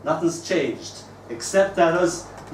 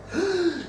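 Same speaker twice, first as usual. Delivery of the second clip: put-on startled child's gasp, much higher; sharp high gasp. Child shocked at teacher's age, gasping high. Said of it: "He gasps".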